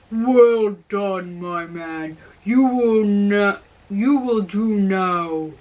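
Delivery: lightly voiced giant, slow and pleased; a hint of a gruff old giant approving. A narrator's reading. Old giant praising the young man, lightly voiced.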